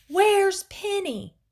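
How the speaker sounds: high, loud, drawn-out alarmed yell. A narrator's reading. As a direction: call out slowly and urgently for a missing child. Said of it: "I heard him yell".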